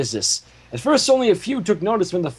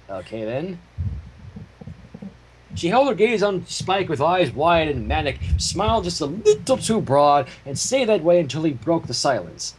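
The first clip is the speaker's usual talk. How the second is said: ordinary delivery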